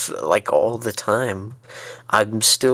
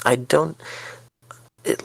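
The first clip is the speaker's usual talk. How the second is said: ordinary delivery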